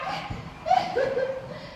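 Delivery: breathless excited giggle; an excited airy laugh; giddy laugh. Acted.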